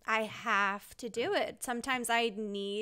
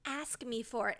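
softer, gentle offer; softly retold offer; 9 dB quieter than usual. Husband Holden softly offering to help. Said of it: "he's like".

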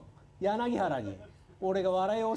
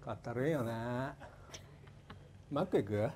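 put-on bored high school boy; 8 semitones lower, 11 dB quieter than usual. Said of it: "高校生男子".

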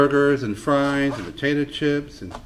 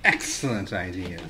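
much lower, deadpan; low catchphrase voice; 9 semitones lower, 6 dB quieter than usual. Pretend. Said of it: "do that again, please".

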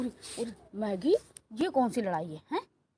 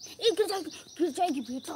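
sudden high, urgent cry of warning; alarmed warning; 8 semitones higher, 3 dB louder than usual. Real, not acted. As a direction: call out in alarm before a fall. Warns pet it will fall. (घबराकर ऊँची आवाज़ में चेतावनी)

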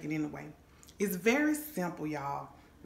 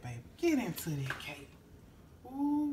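ordinary talk, nothing marked

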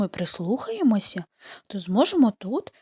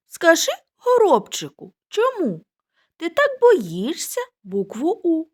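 high sparrow voice; 8 semitones higher, 3 dB louder than usual. Acted. Sparrows talk in story.

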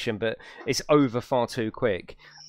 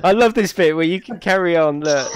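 laughing; 3 semitones higher, 8 dB louder than usual. Real, not acted. Player showing friends a bit he loves, laughing. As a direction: say it through big laughter, louder.

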